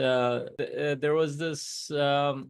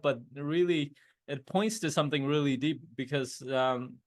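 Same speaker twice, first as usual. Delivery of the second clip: ordinary talk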